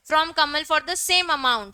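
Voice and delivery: very slow, word-by-word reading; slow dictation. Acted. Teacher dictating the example to students, very slow. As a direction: dictate it so students can write.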